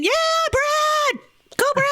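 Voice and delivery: high-pitched mock scream of a cheering fan. Audience member cheering on the singer at a show, screaming. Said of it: "I'd be screaming".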